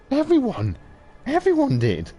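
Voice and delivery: high, loud, desperate cry; frantic yell. Acted. Tortured man yelling his answer at his interrogator, frantic. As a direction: scream the answer out in panic.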